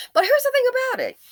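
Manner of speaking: high, eager pivot into the argument. Real, not acted. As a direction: jump up high to grab attention. Launching into the main point.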